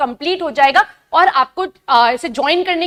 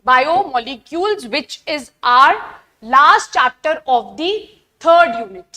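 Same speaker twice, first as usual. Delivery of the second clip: slow dictation; much slower than usual. Shown as a demonstration. Dictating the chapter name slowly.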